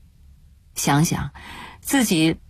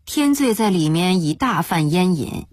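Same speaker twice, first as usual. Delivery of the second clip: ordinary delivery, nothing marked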